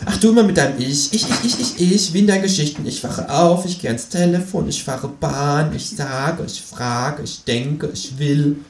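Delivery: needling kangaroo. A narrator's reading. Kangaroo mocks companion's first-person stories, high needling voice. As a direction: mimic his endless "ich" in a high voice.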